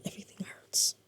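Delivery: everything hurts, barely voiced; pained whisper. Real, not acted. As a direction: whisper it, worn out by pain. In pain, complaining of hurting.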